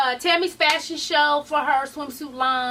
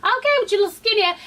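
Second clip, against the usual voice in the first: put-on much higher, fast hype-girl teasing; playful mock shout-out; hyped cheering; 11 semitones higher, 3 dB louder than usual. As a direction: gas up a skinny friend. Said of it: "And I was like".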